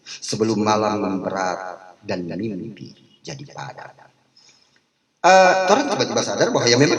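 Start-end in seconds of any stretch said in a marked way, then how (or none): 0.0-3.9 slow, softened poem recitation; poetry reading. Acted. Speaker reciting a poem to a discussion audience, slow poetry reading. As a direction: read the verse slowly, lower and softer toward the end.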